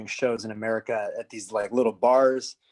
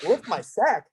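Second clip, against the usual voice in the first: high-pitched incredulous echo; comically aghast retelling; mock disbelief; 8 semitones higher, 4 dB louder, slower than usual. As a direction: throw it back as an appalled question, pitched up. Retold disbelief at officer's order.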